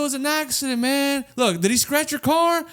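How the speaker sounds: smooth it over as the employee; mock employee. Employee defusing the drive-thru crash, soothing. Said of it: "They're like".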